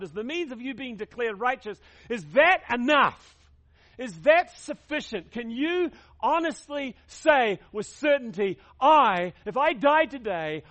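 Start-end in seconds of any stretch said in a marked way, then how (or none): 2.1-5.3 louder, pressing the question home; emphatic preaching challenge; forceful question. Real, not acted. 5.3-10.6 loud, urgent preaching; fervent raised voice; preaching at full force. Real, not acted. Preacher building to a challenge.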